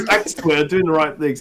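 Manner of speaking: ordinary talk, nothing marked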